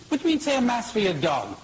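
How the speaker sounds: a priest's indignant, high, fast retort; mock outrage. Priest indignant at a request to say mass for a dog, mock outrage. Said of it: "Father's indignant, he's outraged".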